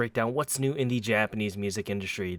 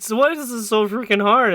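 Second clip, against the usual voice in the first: frustrated shout; 13 semitones higher, 12 dB louder than usual. Retold or imitated. Retelling his own frustrated outburst.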